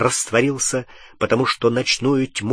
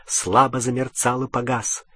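usual speaking voice

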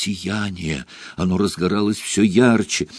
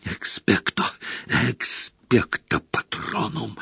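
weak desperate whisper; 7 dB quieter than usual. A narrator's reading. Harry weakening against dementors.

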